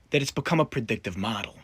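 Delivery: ordinary talk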